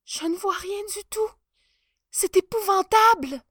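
horrified he sees nothing; panicked chancellor. Acted.

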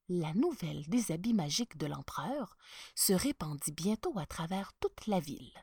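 ordinary delivery, nothing marked

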